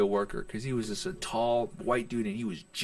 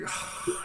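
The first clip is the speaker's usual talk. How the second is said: short acted sigh; retold sigh; 6 dB quieter than usual. Comic told he isn't funny by a heckler, sighing. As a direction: sigh as you replay being heckled.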